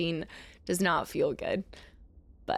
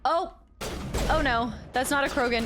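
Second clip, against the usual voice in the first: usual speaking voice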